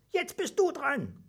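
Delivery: high, slow squeaky urging; fearless mouse call. A narrator's reading. Mouse tells the dragon it is his turn, fearless. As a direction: squeak it slowly and boldly. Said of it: "rief es furchtlos".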